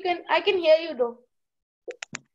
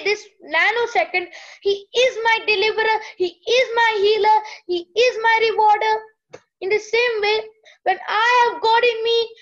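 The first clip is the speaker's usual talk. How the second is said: preaching; 4 semitones higher, 4 dB louder than usual. Acted. Preaching the scripture's power.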